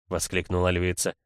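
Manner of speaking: usual speaking voice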